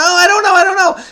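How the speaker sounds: high, loud sing-song I don't know; mock exasperation. Stuck on a crossword clue, mock exasperated. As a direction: throw up your hands, playfully.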